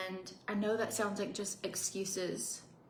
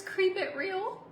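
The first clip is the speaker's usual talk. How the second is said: high, slow spooky reading; 10 semitones higher, slower than usual. Acted. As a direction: read it like a ghost.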